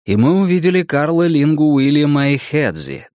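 ordinary delivery

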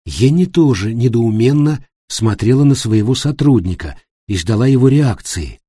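plain reading voice

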